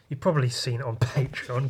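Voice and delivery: normal talking voice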